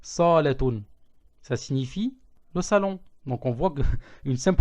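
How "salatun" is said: salatun slow with the sa held; long A on sa; long first vowel. Shown as a demonstration. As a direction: stretch the sa in salatun, not the la. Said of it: "au lieu de prolonger le lem".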